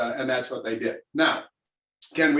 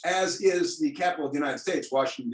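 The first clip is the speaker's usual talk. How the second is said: ordinary delivery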